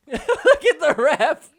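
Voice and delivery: high, loud words through laughter; laughing. Real, not acted. Commentator laughing at the ref picking up leaves. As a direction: crack up while pointing at the ref.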